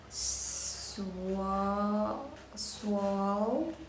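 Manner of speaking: swa drawn out slowly, then swal; sounded out. Shown as a demonstration. Teacher breaking up a new word, sounded out. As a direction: stretch swa and close it into swal. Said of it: "one syllable".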